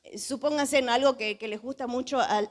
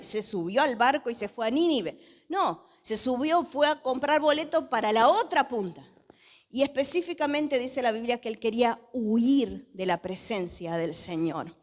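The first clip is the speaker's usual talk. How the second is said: ordinary delivery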